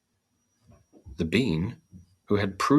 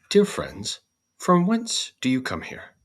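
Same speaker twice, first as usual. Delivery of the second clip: straw's voice; 7 semitones higher, 4 dB louder than usual. Acted. Straw character greets new friends.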